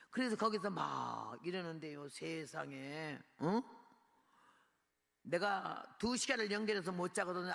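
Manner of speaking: ordinary talk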